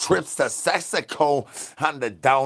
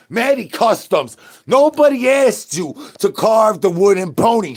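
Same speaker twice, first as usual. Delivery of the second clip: loud scolding; 7 semitones higher, 7 dB louder than usual. Acted. Yelling at Maddie Customs.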